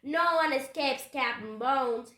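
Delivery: loud, boastful roar; big threatening pirate voice. A narrator's reading. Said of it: "he roared".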